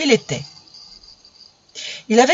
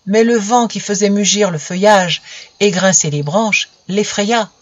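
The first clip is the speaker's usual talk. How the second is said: ordinary delivery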